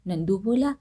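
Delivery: plain reading voice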